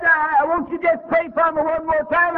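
high, pleading woman's voice; pleading sick woman. Retold or imitated. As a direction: beg him like the frightened patient.

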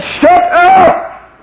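very high, loud shout; shouted rebuke. Retold or imitated. Crowd shouting the beggar down, angry shout. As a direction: bark it at him.

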